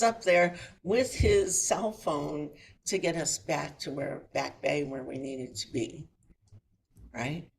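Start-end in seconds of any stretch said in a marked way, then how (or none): none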